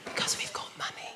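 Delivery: hushed whisper, as if saying a secret. Pretend. Mocking English middle class, whispered aside. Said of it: "middle class low talkers".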